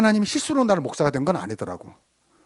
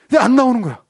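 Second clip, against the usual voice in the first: higher, louder exasperated cry; raised-voice exasperation; exasperated complaint; 6 semitones higher, 6 dB louder than usual. Retold or imitated. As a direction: complain in retold frustration. Retelling frustration at late teenagers.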